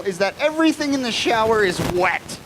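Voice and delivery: ordinary delivery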